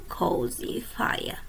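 ordinary delivery

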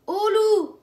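loud high call of alarm; crying wolf. Acted. Shepherd teaching the boy to cry wolf, loud call. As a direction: shout it out to warn everyone. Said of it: "tu cries".

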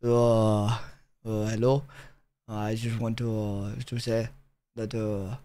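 low, soft, hesitant French accent. Shown as a demonstration. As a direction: murmur haltingly like a Frenchman. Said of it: "Do French?".

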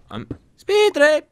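high, loud, stretched call; shouting. Real, not acted. Player retrying the echo in the cave, high shout. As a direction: holler it slowly for an echo. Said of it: "It sounds like you're shouting".

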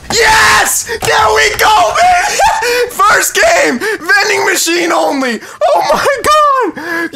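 screaming very high, laughing through it; ecstatic victory scream. Real, not acted. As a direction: scream the win at the top of your range. Screams on winning the game.

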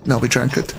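ordinary talk

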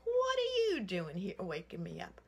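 loud, gruff bear demanding an answer; loud annoyed bear voice; angry bear roar. Acted. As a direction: bellow it like a woken bear. Bear angry at being woken.

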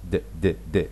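د repeated three times, low and soft. Shown as a demonstration. Teacher drilling the d ending for learners. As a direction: tap out د three times quietly.

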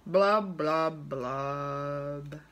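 low, sinking blubs, last one drawn out; gloomy fish. Acted. Pout-pout fish spreads dreary wearies, gloomy low refrain. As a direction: drop down deep and glum, stretching the final word.

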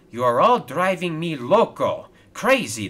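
loud, fed-up outburst; exasperated shout. A narrator's reading. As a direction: raise your voice at the whole crew. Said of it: "shouted Victor".

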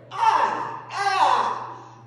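high, loud whoops of glee; delighted whoops. Retold or imitated. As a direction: whoop like a man finding a treat. Friend Hartley finds the rolls.

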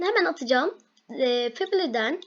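ordinary talk

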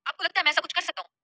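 high, loud protesting outburst; yelling. Acted. Shaurya denying the family's suspicion, yelling. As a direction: cry out in urgent self-defence. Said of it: "फिर चिल्लाते हुए बोला".

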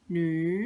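หนุย rising upward; second tone. Shown as a demonstration. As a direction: lift หนุย as Mandarin second tone. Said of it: "คือเสียงที่สาม". (ออกเสียง หนุย เป็นเสียงที่สอง ไล่ขึ้น)

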